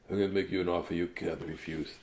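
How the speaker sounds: low, soft, fast godfather mumble; Marlon Brando. Acted. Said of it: "Marlon Brando impression".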